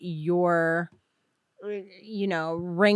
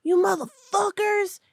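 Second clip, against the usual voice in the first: mock angry outburst; 10 semitones higher than usual. Retelling the man who came out at the brothers, mock fury. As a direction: imitate an outraged man without real volume.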